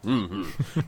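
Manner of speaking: usual speaking voice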